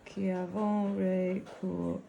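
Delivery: slow French with a northern English accent. Real, not acted. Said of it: "I've got such a northern accent when I try to say French".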